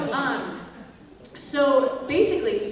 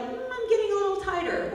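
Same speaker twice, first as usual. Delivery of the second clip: mild mock worry; 10 semitones higher than usual. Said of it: "When you start to feel like".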